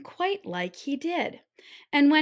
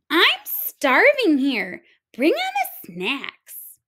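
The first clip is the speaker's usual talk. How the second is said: put-on slow, breathy, greedy kid; bossy girl voice; 6 semitones higher, 7 dB louder, slower than usual. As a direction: whine for food like a spoiled kid. Hungry girl demands food.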